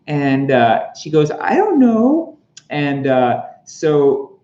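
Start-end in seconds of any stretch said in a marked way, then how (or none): 1.3-2.4 put-on much higher, slow old lady voice; helpless grandma voice. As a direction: squeak it out like a frail grandma. Grandma answers Happy.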